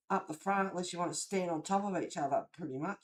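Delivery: usual speaking voice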